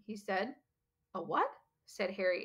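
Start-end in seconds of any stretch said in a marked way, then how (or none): 1.1-1.5 curious. A narrator's reading.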